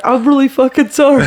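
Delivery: comedian apologizing to Rachel, mock shout. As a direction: blurt the apology up high. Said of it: "And he's like".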